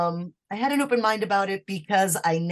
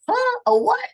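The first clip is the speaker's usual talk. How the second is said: very high, loud startled questions; hammed-up bewildered surprise; caught off guard; 6 dB louder than usual. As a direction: act out being completely thrown. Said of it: "completely caught off guard".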